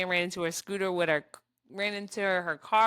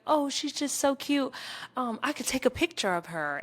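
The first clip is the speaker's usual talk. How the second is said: creepy stranger; 6 semitones higher than usual. Retold or imitated. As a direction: lightly play the creepy man fawning over the baby. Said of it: "this man was like".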